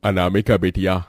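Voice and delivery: ordinary talk, nothing marked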